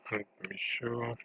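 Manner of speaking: ordinary delivery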